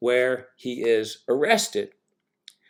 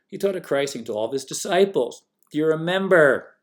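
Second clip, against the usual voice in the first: ordinary delivery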